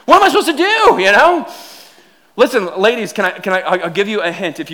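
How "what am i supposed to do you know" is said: high, strained mock panic; lost panic. Husband lost in the food closet, high mock panic. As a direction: cry out, lost and helpless. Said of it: "I'm just like".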